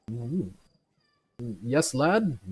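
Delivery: put-on a startled drunk answering a stranger; drunk man. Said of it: "kinda turns around and goes".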